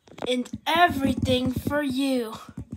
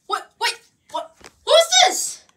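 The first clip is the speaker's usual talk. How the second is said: startled; 13 semitones higher, 6 dB louder than usual. Acted. Startled new voice.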